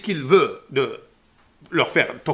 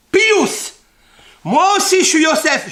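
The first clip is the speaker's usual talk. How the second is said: loud outcry; 12 semitones higher, 10 dB louder, slower than usual. Acted. Preacher voices Yosef's outburst.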